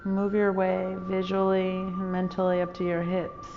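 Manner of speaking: calm, even meditation voice with long pauses; guided meditation. Real, not acted. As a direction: guide listeners unhurriedly into stillness, phrase by phrase.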